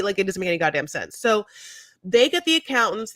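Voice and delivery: normal talking voice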